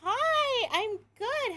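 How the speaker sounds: high-pitched, loud squealed hi; excited greeting. Real, not acted. Streamer answers a greeting from chat, excited squeal. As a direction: start high and loud, easing on the reply.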